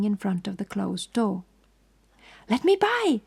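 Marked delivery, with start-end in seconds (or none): 2.5-3.2 much higher terrified shriek. A narrator's reading. The woman shrieks at Poirot blocking the door, terrified. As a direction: scream to get past. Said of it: "she shrieked".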